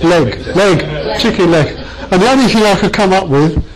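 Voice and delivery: giggling through the words; amused, can't keep a straight face; laughing. Real, not acted. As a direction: say it while laughing at yourself. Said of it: "sorry, I'm being stupid now".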